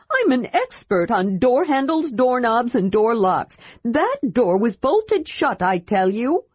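hurt pride; indignant. Acted. Tas insisting.

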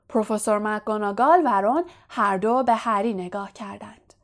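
usual speaking voice

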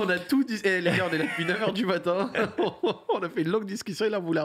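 laughing while talking. Real, not acted. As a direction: talk through a real laugh. Jokes about redoing the interview.

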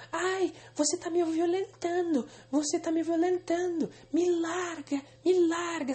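high, hammed-up screaming girl; mock hysterical cry. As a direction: overplay her fake panic, high and slow. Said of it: "E depois que o pai dela fez isso".

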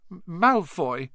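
put-on frightened boy stumbles over the name; shaky. A narrator's reading. Neville tells friends who hurt him, shakily.

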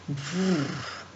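lips trilled in a quiet shiver; a scared little brrr, softly. Acted. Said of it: "frissonne le marquis Frisson".

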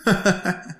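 short string of chuckles; teasing brotherly laugh; playful laugh. Acted. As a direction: laugh lightly after calling.

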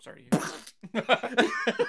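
slow, laughing fake condolence; laughing mock sympathy. Real, not acted. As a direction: pretend to be sorry through laughter.

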